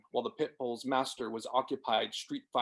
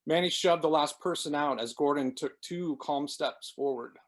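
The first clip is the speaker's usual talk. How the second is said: ordinary delivery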